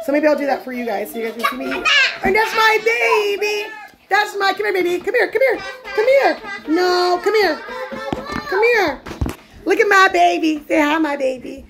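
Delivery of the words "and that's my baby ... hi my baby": high, sing-out mommy voice; excited high-pitched talk to a little one. Real, not acted. Cooing at and calling her baby.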